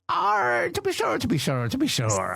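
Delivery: put-on Irish pirate accent. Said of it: "Irish pirates go".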